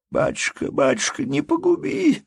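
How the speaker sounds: high pleading cry, climbing sharply; sobbing plea. A narrator's reading. Peasant woman at the steward's feet begging mercy, sobbing. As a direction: beg desperately, tearfully. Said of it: "твердила она, рыдая".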